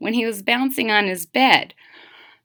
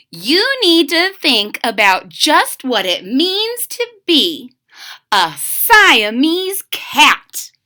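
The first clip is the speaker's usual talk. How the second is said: slow, loud, higher, every word punched; emphatic lecture; 7 semitones higher, 7 dB louder, slower than usual. A narrator's reading. Mother drilling her kitten on being a cat, emphatic. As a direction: drive each rhyme home slowly.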